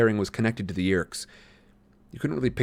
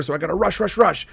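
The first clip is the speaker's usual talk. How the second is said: plain reading voice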